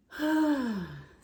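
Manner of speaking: loud high sigh, falling away; heavy sigh. Real, not acted. Sighing before the trigger warning, a loud heavy exhale. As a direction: let out a big falling sigh. Sighs before hard news.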